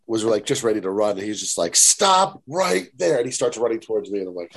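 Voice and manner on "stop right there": slow, higher, louder command to halt; retold stern yell. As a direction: stop him in his tracks, drawn out. Teacher catches him in hallway.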